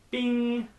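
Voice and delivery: a loud, high mock bell ding; bing sound. Vlogger revealing his final pick with a loud, high mock ding. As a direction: ring out a high, bright bing.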